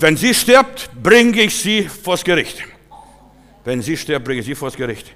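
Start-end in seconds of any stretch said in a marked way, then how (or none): 0.0-2.6 loud, high, drawn-out threat; angry threat. Retold or imitated. Husband threatens the pastor with court, angry threat. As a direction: imitate a furious caller, spacing the words out slowly. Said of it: "ganz entsetzt und böse".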